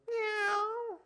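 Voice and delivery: high-pitched meow from a dog; imitated cat meow. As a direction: mimic a cat's long, high meow. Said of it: "my dog, Kizzy, sa-, goes".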